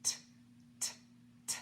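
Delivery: "t" said as a crisp breathy tap, no vowel after it; t sound. Shown as a demonstration. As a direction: tap out "t" alone three times, just air and tongue. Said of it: "The sound that the letter T makes".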